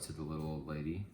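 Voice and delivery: ordinary delivery, nothing marked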